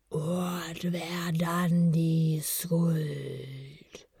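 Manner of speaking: very slow, whispered, drawn-out incantation; sonore, körperlose Stimme. A narrator's reading. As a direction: intone it like a bodiless voice, stretching every word. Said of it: "vom sonoren Klang der körperlosen Stimme".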